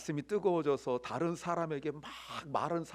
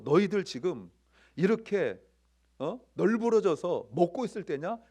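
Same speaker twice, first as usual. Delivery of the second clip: louder, blunt acted telling-off; coarse mock scolding; 4 semitones higher, 7 dB louder than usual. Preacher as Jesus rebuking disciples reclining at dinner, louder mock scolding. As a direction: tell off lounging diners in rough everyday words. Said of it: "좀 속된 말로".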